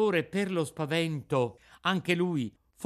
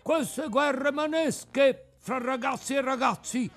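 much higher, slow, strained nagging voice, louder; crab with a cold; 12 semitones higher, 5 dB louder, slower than usual. A narrator's reading. Crab scolds the brawling boys, high slow nagging cry. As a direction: bellow like a stuffed-up old trombone. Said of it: "con una vociaccia di trombone infreddato".

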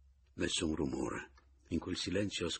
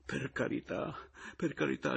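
high, whimpering begging; frightened man pleading; moaning plea; 8 semitones higher than usual. A narrator's reading. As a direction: moan it pitifully. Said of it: "gemette Pomino".

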